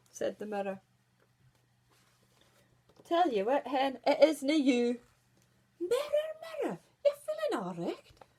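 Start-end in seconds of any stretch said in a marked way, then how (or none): 3.0-5.0 much louder, cheeky verdict; blunt mirror. Acted. 5.8-8.2 very high, shocked query; can't believe her ears; incredulous queen. Acted. Queen doubts mirror.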